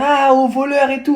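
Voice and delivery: high, slowed mock yell of "au voleur"; mock cry of thief. Ex calling thief in front of her school, mock high yell. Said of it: "elle commence à crier devant tout le monde".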